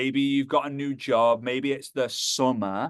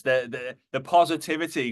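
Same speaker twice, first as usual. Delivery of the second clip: ordinary talk, nothing marked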